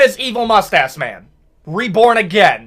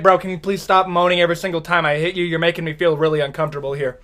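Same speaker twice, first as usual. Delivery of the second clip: fast awkward complaint; 3 semitones lower, 4 dB quieter, faster than usual. Acted. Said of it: "he's like".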